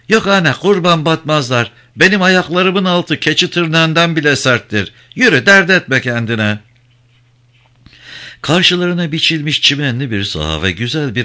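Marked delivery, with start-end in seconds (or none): 0.1-6.6 put-on higher, reassuring old mother's voice; old woman telling the young man her feet are tough. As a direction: brush off his worry kindly. Old Zine reassures Cano.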